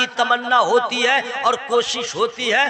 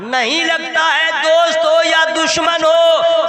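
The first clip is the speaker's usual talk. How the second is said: ordinary delivery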